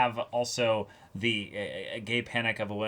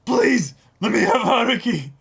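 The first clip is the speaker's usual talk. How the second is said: high, loud begging plea; hammed-up pleading lover; pleading Kaji; 10 semitones higher, 8 dB louder than usual. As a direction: beg like a desperate lovesick man. Kaji pleading, voiced quote.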